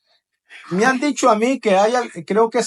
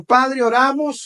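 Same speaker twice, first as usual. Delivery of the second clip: opening prayer; 4 semitones higher, slower than usual. Acted.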